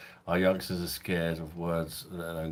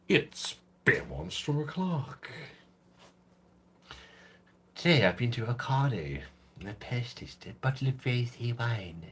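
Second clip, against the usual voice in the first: higher, hammed-up posh English accent; 5 semitones higher than usual. Streamer obliges a viewer's request, hammed-up posh accent. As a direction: put on a mock upper-crust posh accent. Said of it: "Can we have a posh accent?".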